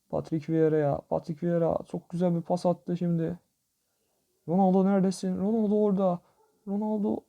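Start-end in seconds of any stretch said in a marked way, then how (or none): 4.5-7.2 eager calling. Real, not acted. Looking for his striker.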